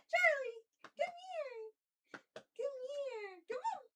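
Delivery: high sing-song coaxing; talking to a pet. Real, not acted. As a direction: coax the dog in a high voice. Coaxing the dog to come.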